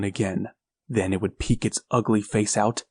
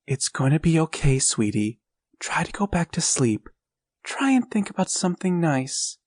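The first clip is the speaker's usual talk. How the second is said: comforting mother; 7 semitones higher than usual. A narrator's reading. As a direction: soothe a scared boy at bedtime. Mother soothing son.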